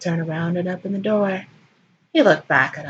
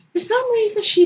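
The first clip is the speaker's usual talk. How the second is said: squeaky high-pitched quip; sudden comic falsetto; mock high aside; 4 dB louder than usual. A narrator's reading.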